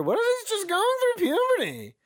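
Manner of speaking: very high, squeaky puberty voice; voice cracking. Shown as a demonstration. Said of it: "all of a sudden it cracks".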